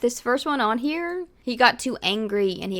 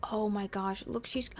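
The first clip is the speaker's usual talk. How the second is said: ordinary talk, nothing marked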